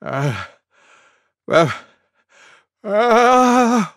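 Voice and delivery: gasped "uh" rising to high and loud; halting pre-sneeze gasps, growing bigger; building sneeze. Acted. Elephant about to sneeze, mock gasps building. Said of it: "went Elephant".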